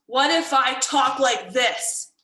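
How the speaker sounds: upset tone. Shown as a demonstration. Teacher demonstrating a tone for students to guess, upset. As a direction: say it slowly and firmly, as if cross. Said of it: "that's an upset tone".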